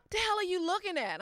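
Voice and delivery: high, half-laughing mock snap; mock snapping friend. Friend catches her staring, a mock laughing snap. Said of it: "I'm always looking at her".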